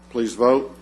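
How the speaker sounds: ordinary talk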